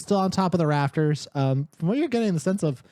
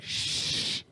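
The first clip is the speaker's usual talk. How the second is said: soft unvoiced shush; shushing whisper; 8 dB quieter than usual. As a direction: hush them with a whispered shh. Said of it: "she goes like".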